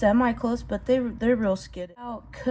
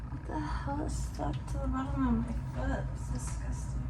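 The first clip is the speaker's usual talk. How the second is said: muttered, much softer, grossed out; disgusted undertone; 2 semitones higher, 10 dB quieter than usual. Real, not acted. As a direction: grumble it half under breath in disgust. Disgusted at something stuck to foot.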